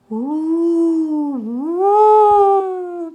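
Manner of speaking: rising, loud hooting wind; wind woo. Acted. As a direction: howl it like the wind. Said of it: "blew the wind".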